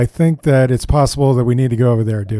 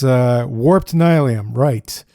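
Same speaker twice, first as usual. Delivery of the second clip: usual speaking voice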